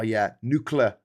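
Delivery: ordinary talk, nothing marked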